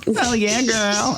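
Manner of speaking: put-on high sassy "oh yeah girl". Imitating someone he watched, high and sassy. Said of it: "I watched somebody do this the other day".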